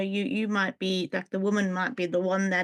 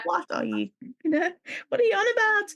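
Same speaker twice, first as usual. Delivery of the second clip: baffled disbelief; 10 semitones higher than usual. Retold or imitated. Acting out her baffled reaction.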